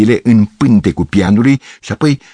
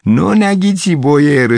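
mock cry; 8 semitones higher, slower than usual. A narrator's reading. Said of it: "strigă el".

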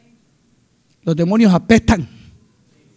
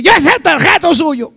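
higher quoted voice of another man; imitasi pria lain; 7 semitones higher than usual. Retold or imitated. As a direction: act out the man's retort in a raised pitch. Quoting the man who confronted him.

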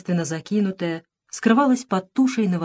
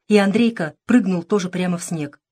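plain reading voice

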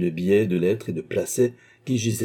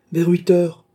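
high, loud short reply; calling back; 10 semitones higher, 8 dB louder, slower than usual. A narrator's reading. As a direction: shout it up from the terrace. Concierge shouts back from below.

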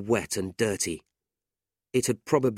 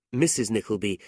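ordinary delivery, nothing marked

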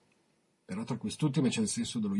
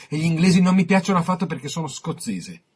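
higher and louder, proudly declaring it; emphatic outburst; 6 semitones higher, 7 dB louder than usual. Acted. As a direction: hammer home the Scottish pride. Connery's proud anti-English outburst. (con orgoglio, a voce alta)

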